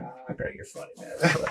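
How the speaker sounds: unvoiced aside, barely there; breathy whisper. GM laughing off the question in a near whisper. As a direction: brush it off under your breath.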